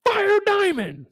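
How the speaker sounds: high, slow squeaky imitation; high pleading voice mocking Derek; mock whiny man. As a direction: drag it out in a high whine. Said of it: "This man on the phone talking about".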